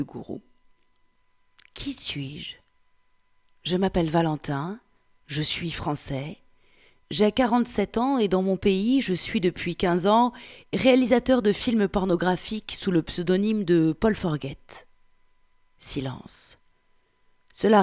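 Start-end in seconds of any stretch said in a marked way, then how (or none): 1.7-2.6 hushed question. Acted. Guru speaker asks opening question. 15.8-16.6 whispered aside. A narrator's reading. Narration marks a pause.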